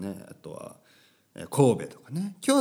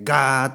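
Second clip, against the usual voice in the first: imitated spin noise; 4 semitones higher, 9 dB louder than usual. Host mimics the washing machine's spin, loud roaring noise. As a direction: roar like a washer on final spin. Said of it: "日本語は擬音も多いですからね".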